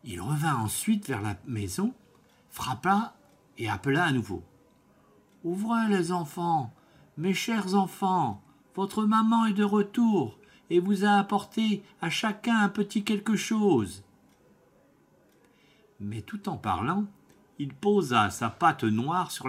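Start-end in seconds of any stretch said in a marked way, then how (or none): 5.4-14.0 a higher, sweetened wolf voice faking the mother; wolf's softened voice. Acted. Wolf faking the mother's voice to the kids, higher and sugary. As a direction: coax them sweetly, lightly voiced. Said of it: "sa voix devint plus douce".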